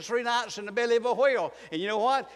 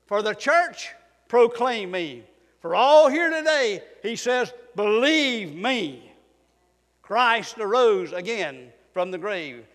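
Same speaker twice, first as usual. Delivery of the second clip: full-force preaching; 5 dB louder, slower than usual. Acted. Preaching climax, building to full force.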